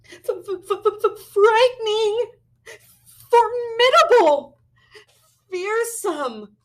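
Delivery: very high, loud, stuttering fear; scared stammer. Acted. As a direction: stammer the words out terrified.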